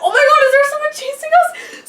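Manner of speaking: high, fast, laughing panic; panicked. Retold or imitated. Fleeing to the car, in a high, laughing panic. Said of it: "And I was like".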